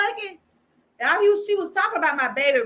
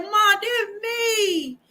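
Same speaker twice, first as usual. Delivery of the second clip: tearful; 7 semitones higher, much slower than usual. Retold or imitated. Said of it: "I hope I don't cry when I say this".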